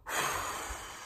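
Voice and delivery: breathy wind blow; soft unvoiced whoosh. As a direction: blow out like a wolf. Wolf blows house.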